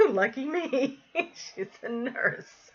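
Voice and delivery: high, slow words through laughter; laughing. Real, not acted. Telling how the neighbor was a nurse, amused laughing. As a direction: laugh it out, pitched well up.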